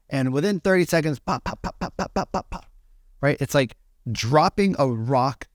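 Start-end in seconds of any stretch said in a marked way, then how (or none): 1.2-2.6 soft high pop pop pop like bubbles bursting; quiet popping sounds. Retold or imitated. Thoughts popping up as bubbles, soft high pops. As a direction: tap out tiny pops, softer.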